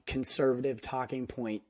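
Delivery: usual speaking voice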